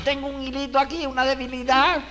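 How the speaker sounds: high falsetto imitation; mock feeble wife. Man mimics wives complaining after a big meal, feeble falsetto. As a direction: put on a high, feigned-weakness woman's voice. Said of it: "te están diciendo".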